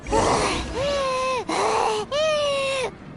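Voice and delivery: long, heavy sigh of exhaustion; exhausted sigh. Acted. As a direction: sigh out like your arms are about to fall off. Worn out after carrying boxes.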